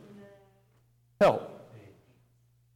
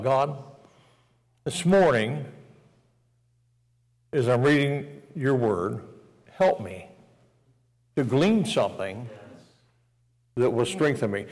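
quiet prayer; 3 semitones lower, 4 dB quieter than usual. Acted. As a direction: pray it gently, alone with God. Quoting a morning prayer.

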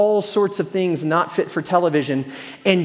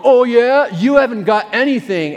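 loud taunt; 4 semitones higher, 4 dB louder than usual. Retold or imitated. Tackled guy taunting back.

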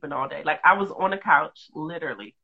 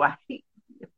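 soft mock hate; 9 dB quieter, faster than usual. Shown as a demonstration. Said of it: "Like that".

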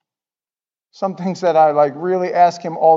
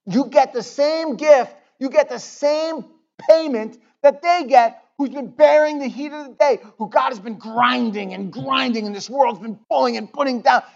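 higher, slowed, emphatic preaching; building intensity; 9 semitones higher, 4 dB louder than usual. Real, not acted.

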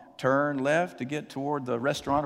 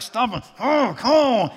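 a hint of a furious driver; higher, louder ranting; retold road rage; mock angry yell; 6 semitones higher, 4 dB louder than usual. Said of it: "he's like".

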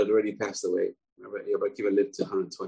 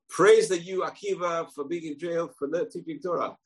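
lightly raised, high voice of praise; the nephew honoring his uncle in the cell; a hint of an admiring nephew; 7 semitones higher than usual. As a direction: look up to your uncle a little. Nephew praises Akiva in jail.

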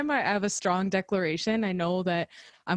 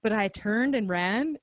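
usual speaking voice

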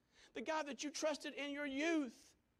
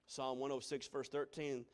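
ordinary talk

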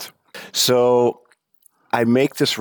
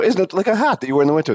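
high, fast, disbelieving question; incredulous; 12 semitones higher, much faster than usual. Retold or imitated. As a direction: rush it out, pitched way up. Speaker's incredulous question to locals.

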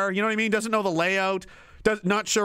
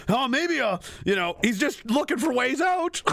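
ordinary delivery

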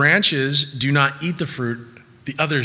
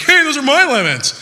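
much higher, louder indignant squeal; mock angry tree; 13 semitones higher, 7 dB louder than usual. Lemon tree scolding the kids, in a high indignant squeal. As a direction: play the tree protesting. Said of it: "the tree wasn't like".

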